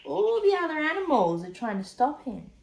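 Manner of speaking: usual speaking voice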